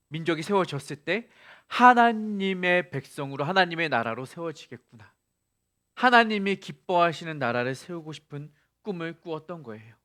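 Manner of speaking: 크게 높여 외치는 설교; 회중을 향해 목소리를 끌어올려 선포하듯. Acted. (교인들에게 꿈을 외치는 힘찬 설교)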